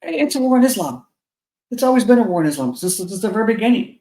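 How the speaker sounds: normal talking voice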